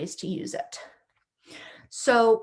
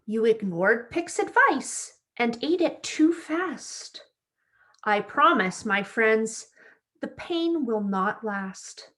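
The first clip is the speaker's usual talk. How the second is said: bright, lively storybook read-aloud; reading to children; slower than usual. A narrator's reading. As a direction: read it like story time for little kids. Reads picture book to children.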